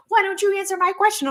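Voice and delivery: high-pitched mock whining outrage; mock pissed off. Annoyed person demanding the doctor's answer, high mock outrage. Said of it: "people get pissed".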